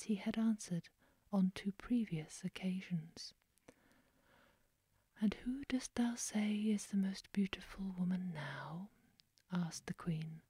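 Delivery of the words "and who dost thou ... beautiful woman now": usual speaking voice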